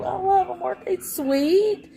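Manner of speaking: high, gushing thanks over the pajamas; bubbly high squeal of delight; delighted cooing. Real, not acted. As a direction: coo over a sweet gift. Thanking viewer for pajama compliment.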